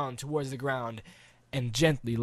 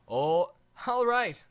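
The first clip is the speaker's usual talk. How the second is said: slow, higher reluctant agreement; reluctant boy; 6 semitones higher, 3 dB louder, slower than usual. Acted. Boy grudgingly agreeing to the dive, slow. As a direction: give in like a kid, slowly.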